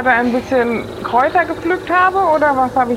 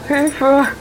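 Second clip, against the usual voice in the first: Hilferuf; 6 semitones higher, 4 dB louder than usual. Acted. Geist greift die Figur an.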